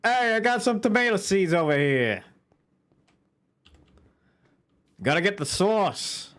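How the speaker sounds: put-on high, loud New York accent; brash New York guy accent. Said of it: "why did the New York accent slip out".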